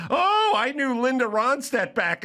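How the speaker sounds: high-pitched gushing mimicry; mock shrew. Politician imitating his wife starting up, high mock shrew. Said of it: "when this shrew starts up with the Spanish".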